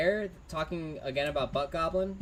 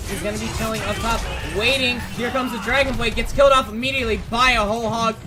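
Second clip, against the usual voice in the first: live play-by-play; 2 semitones higher, 7 dB louder than usual. Acted. Caster calling the fight to viewers, louder and building. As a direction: build the fight call toward the ult.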